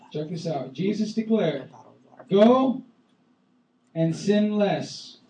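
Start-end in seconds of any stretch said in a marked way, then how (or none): 2.3-5.2 loud go, then slow sin less; mock misquote, slow and loud; wrong version. Shown as a demonstration. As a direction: drag out sin less mockingly. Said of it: "Is that what he said?".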